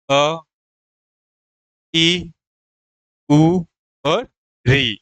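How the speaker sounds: अ, ई, उ, री each said short and separated; short vowels listed. Shown as a demonstration. Teacher listing short vowels for students, clipped and separate. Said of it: "ह्रस्व स्वर वह स्वर होता है".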